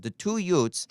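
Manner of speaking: put-on high, loud Brooklyn accent on Utes. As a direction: say it like a Brooklyn lawyer. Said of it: "like, Brooklyn accent".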